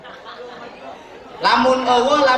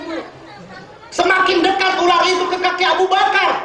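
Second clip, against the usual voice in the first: fervent preaching; 9 semitones higher than usual. Acted. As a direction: proclaim it to the hall with full force. Preacher builds the snake story. (berkhotbah dengan suara tinggi dan lantang)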